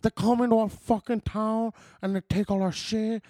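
hammed-up Cuban gangster speech; Al Pacino. Friend acting the movie's weird speech, Scarface impression. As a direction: rant like a cartoon Scarface. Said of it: "your, uh, Pacino impression".

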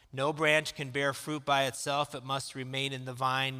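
usual speaking voice

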